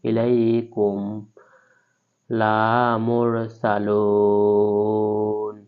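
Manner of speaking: ইলাইকুম লা মুরসালুন with vowels held very slowly; stretched recitation. Shown as a demonstration. Teacher reciting slowly to show lengthening. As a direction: draw every long vowel out, chanting. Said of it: "তে আলিফ টেনে পড়তে হবে".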